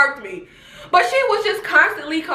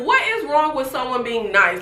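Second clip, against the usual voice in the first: ordinary delivery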